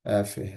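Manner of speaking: অ্যাফে said softly as "eh-feh"; teacher modeling the exact sound; proper sound of F. Shown as a demonstration. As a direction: give the perfect sound of অ্যাফে. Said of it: "সাউন্ডটা হচ্ছে এরকম অ্যাফে".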